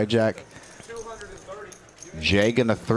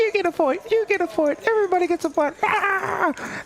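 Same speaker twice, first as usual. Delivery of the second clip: squeaky high mock voice. Mocking partner's point giveaway.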